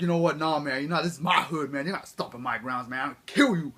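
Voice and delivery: loud, swaggering street-tough voice; fake tough gangster. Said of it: "But Godzilla was like".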